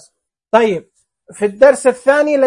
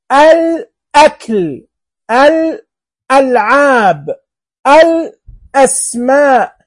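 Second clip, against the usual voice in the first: slow, higher, each hamza cut off sharply; clear glottal stop; 4 semitones higher, 3 dB louder, much slower than usual. Shown as a demonstration. Teacher to pupils, showing the cut hamza slowly and clearly. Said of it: "شوف قطعنا الكلام".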